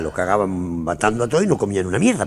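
ordinary talk, nothing marked

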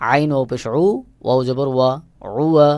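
ordinary talk

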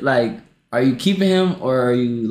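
ordinary talk, nothing marked

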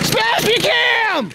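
high, drawn-out holler; excited call-out. Real, not acted. Calling out during the stunt.

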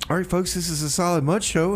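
normal talking voice

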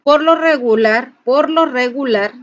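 ordinary delivery

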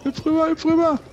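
high-pitched frantic urging; shrieking jackpot winner. Real, not acted. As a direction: shriek it out in a panic. Urging character to jump.

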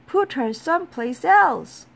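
angry team shouting, high and loud. A narrator's reading. Angry teammates ordering a player moved, high shout. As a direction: shout it as a cross ball team. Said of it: "they shouted".

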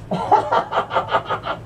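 high, rapid giggling cackle; cackling laugh. Pretend. Smoker laughing at Gary, high cackle. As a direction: burst into a high stoned cackle.

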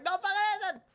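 high, squeaky mock protest; exasperated retort. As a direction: contradict them in strained high exasperation. Exasperated retort to imagined believers.

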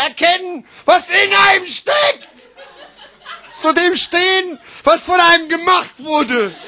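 high, emphatic, exclaimed phrases; grand slam declaiming. Acted. Slam poet exalting self-expression, grandly exclaimed. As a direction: proclaim each phrase like a great triumph.

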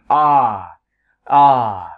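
"Are" with a long, drawn-out open a. Shown as a demonstration. Teacher showing students the long vowel, drawn out. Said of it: "bản thân nó làm a dài".

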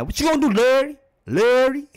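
LARRY pitched high and loud, hard L; mad emphasis on L. Shown as a demonstration. YouTuber shows how angry people say Larry, hard mad L on Larry. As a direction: hit the L in Larry like you are mock mad. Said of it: "put the emphasis on ... when they mad".

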